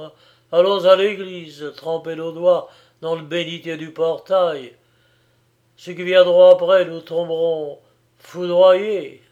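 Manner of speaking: loud, sarcastic fury; mock her prayer, shouting bitterly; bitter rage. A narrator's reading. Young man mocks her prayer in a loud bitter rage. Giovanni's bitter raging mockery.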